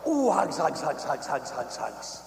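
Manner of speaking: repeated squeeze-hug patter, falling away; playful hugging. Retold or imitated. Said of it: "squeeze her real tight and I'd go".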